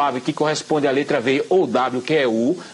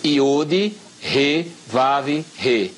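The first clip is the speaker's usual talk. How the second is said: very slow, each Hebrew letter name set apart; slow, careful letter-by-letter reading; deliberate repetition; much slower than usual. Shown as a demonstration. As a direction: spell out Iod, Re, Vav, Re one at a time, with long gaps. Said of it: "Repita, repita a pa".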